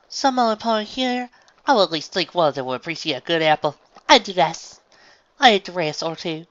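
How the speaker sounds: high, loud, careful fancy speech; farm mare putting on refinement. Acted. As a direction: strain to sound like a lady.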